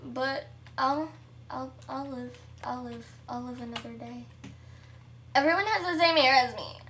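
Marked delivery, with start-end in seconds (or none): 5.3-6.8 quick, loud playful complaint; loud hammed-up grumble; mock outrage. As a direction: protest with pretend anger. Mock complaint, then taken back.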